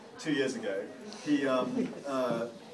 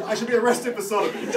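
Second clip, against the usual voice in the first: laughing; 9 semitones higher, 9 dB louder than usual. Retold or imitated. Father's joke retold, laughing.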